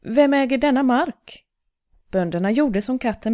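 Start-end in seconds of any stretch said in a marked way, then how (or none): 0.0-1.4 a king calling out across the fields. A narrator's reading. King calling to the farmers, high carrying call. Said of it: "ropade kungen".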